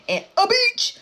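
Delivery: sudden high, loud outburst; odd shouted emphasis. Real, not acted. Storyteller repeats backyard detail to viewers, shouting high. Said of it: "I don't know why I said it like that".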